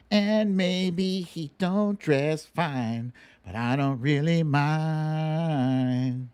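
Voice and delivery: lightly dramatic read of pop lyrics for a guessing game; lyric recitation. Acted. Reciting song lyrics as a clue.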